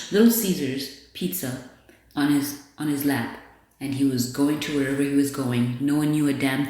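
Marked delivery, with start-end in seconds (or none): none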